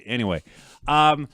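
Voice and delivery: ordinary talk, nothing marked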